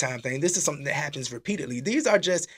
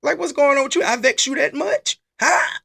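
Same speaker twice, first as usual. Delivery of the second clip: much higher, louder indignant questioning; mock challenge; 10 semitones higher, 4 dB louder than usual. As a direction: demand answers like you're offended. Acted challenge to the usher.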